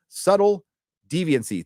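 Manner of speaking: high, loud first word, then easing off; punched name call-out. Real, not acted. Reads donor name, later repeated softly.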